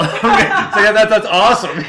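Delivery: cracking up while talking; laughing. Real, not acted. Host cracks up telling the song anecdote, laughing.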